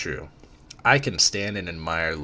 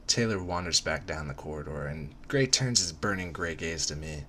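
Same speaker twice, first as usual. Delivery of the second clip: usual speaking voice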